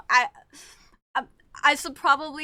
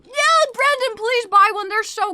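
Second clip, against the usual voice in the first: high loud plea; 8 semitones higher, 6 dB louder than usual. Real, not acted. Urging friend loudly.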